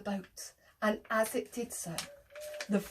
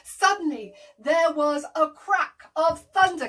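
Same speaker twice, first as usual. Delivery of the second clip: ordinary delivery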